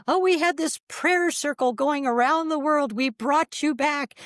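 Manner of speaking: high, gushing imitation of mom; mother's delighted voice. Mom telling of prayer circle that brought her back, gushing. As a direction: put on a pleased mother, pitched well up. Mother's proud news, acted.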